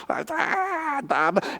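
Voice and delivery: high broken crying sound, then fading; choked cry. Retold or imitated. Retelling the social worker, choked mock cry. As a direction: let her break into a stunned cry.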